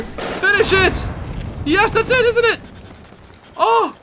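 high excited cheering; elated victory. Real, not acted. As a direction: celebrate beating the Gleeok. Boss defeated, elated.

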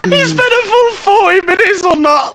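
very high, loud squealing voice; helpless hilarity. Real, not acted. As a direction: squeal it out, in hysterics. In hysterics at friend's wasted effort.

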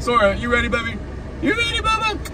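sweet high coo to the pup. Real, not acted. Talking to his dog.

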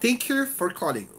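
YOU high in "Thank you for calling"; stressed words. Shown as a demonstration. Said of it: "particular words that you want to stress".

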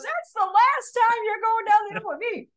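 high, mock scolding brother; the brother laying down the law in a put-on voice; angry older brother. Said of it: "and he goes".

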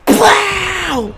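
high, astonished wow; real cry of amazement; excited shout. Real, not acted. Reacts to a big play.

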